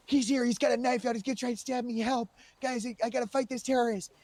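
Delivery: high, loud call for help; urgent radio call. Retold or imitated. A cop radios his team for backup, urgent and loud. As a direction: shout for backup mid-fight. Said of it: "I went in there and I said".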